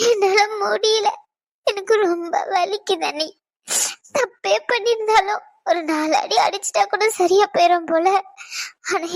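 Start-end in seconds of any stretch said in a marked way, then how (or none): 0.1-3.3 slow, tearful words breaking into a cry; sobbing. Acted. Wife sobbing to her sister-in-law about the pain, slow and tearful. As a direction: let the hurt crack the voice, slowly.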